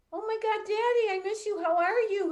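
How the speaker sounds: like hearing a lost parent's voice again; overjoyed cry. Retold or imitated. Daughter greeting her late father on the dream phone, overjoyed and high.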